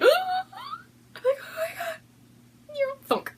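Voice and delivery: very high, tearful squeal; hammed-up starstruck gasp; mock fangirl squeal. Vlogger imagining meeting the Yarn Harlot, mock fangirl squeal. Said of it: "I may be like".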